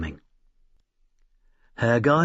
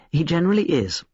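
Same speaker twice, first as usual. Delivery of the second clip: high, catty little jab; a thin girl's spiteful aside; 6 semitones higher, faster than usual. A narrator's reading. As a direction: slip the barb in spitefully. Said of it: "rather spitefully".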